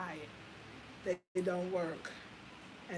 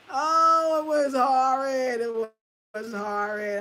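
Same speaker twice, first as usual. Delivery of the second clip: anguished; 5 semitones higher, 11 dB louder, slower than usual. Real, not acted. Reliving the ordeal.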